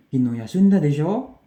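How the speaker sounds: "desho" with a rising questioning tune; nada naik. Shown as a demonstration. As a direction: ask to confirm, lifting the pitch on "desho". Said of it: "dengan nadanya itu naik". (intonasi naik di akhir "desho")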